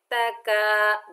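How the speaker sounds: तका with a light thin kaf and long a. Shown as a demonstration. Teacher showing a thin kaf in तका. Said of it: "काफ को हमने बारीक पढ़ना है".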